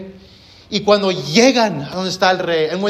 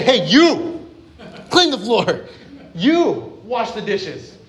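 higher-pitched, punchy mock commands; blunt household orders; 3 semitones higher, slower than usual. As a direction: boss the housemates around, hammed up. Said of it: "Nobody talks like that. You say".

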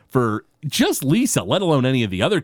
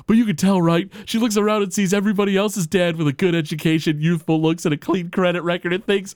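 a lightly voiced Homer, higher; Homer imitation; 7 semitones higher than usual. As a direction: ramble on like Homer, a bit higher. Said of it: "Homer's like".

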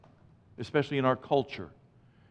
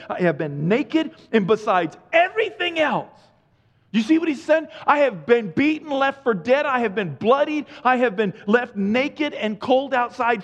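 higher and louder, pleading list; rising fervour; 6 semitones higher, 4 dB louder than usual. Acted. Preacher reading Paul's sufferings, fervent and loud. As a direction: build it up, pressing harder each line.

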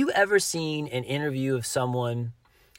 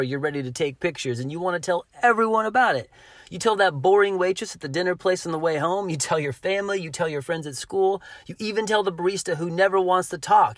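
ordinary delivery

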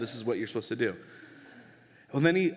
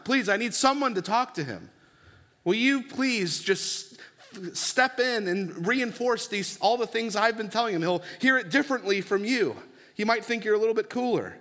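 higher pleading plea, slowing on please just; a mother pleads with the pastor to talk sense into her son, in a higher, begging voice; 7 semitones higher than usual. Retold or imitated. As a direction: beg the pastor as a worried mom.

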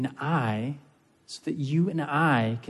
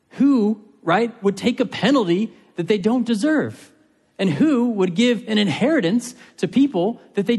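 higher and louder, pressing the question home; passionate preaching; 7 semitones higher, 4 dB louder than usual. Real, not acted. Preacher urging his congregation, raised and passionate. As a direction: pour astonishment into each question.

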